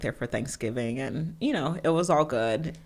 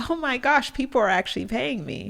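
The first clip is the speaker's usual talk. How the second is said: amazed excitement; 10 semitones higher than usual. Retold or imitated. Retelling first earnings at eighteen.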